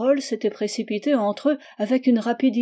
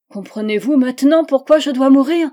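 cri de désespoir; 5 semitones higher, 10 dB louder than usual. Acted. Charlotte justifie sa mort.

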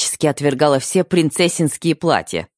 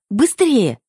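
urgent; 10 semitones higher than usual. Acted. Bride urging taxi driver.

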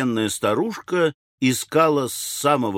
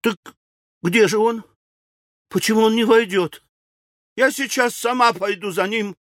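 high, louder agitated widow; взволнованно; 11 semitones higher, 3 dB louder than usual. A narrator's reading. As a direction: burst out impatiently, pitched way up. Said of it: "взволнованно сказала миссис Джипс". (взволнованно, высоким громким голосом)

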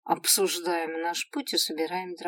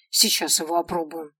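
ordinary delivery, nothing marked

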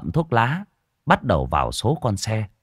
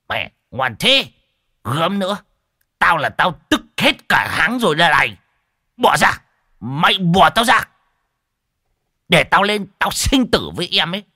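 higher and louder, straining to break free; rising, worked-up old lecher; excited lecher; 9 semitones higher, 5 dB louder than usual. Acted. Old man fights his friends to get at the dancer, loud and excited.

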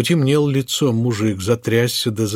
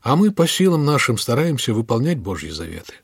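usual speaking voice

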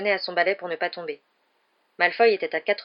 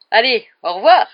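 booming, higher goodbye; loud farewell; 5 semitones higher, 14 dB louder than usual. A narrator's reading. Lockhart leaving Hagrid's cabin, calling out goodbye. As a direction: call out a showy farewell. Lockhart takes breezy leave.

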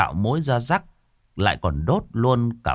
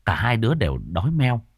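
usual speaking voice

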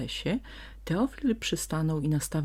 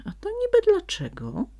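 very high, loud feigned astonishment; mock surprise; 7 dB louder than usual. A narrator's reading. Donkey pretends to be surprised at the fox, hammed up. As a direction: fake innocent surprise. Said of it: "udał zdziwienie Teofil".

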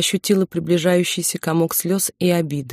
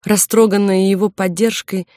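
ordinary delivery, nothing marked

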